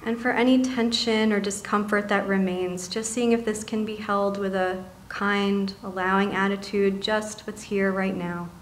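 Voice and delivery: soft, calm meditation voice with long pauses; guided meditation. Acted. Meditation leader settling seated listeners into body and breath, softly guiding.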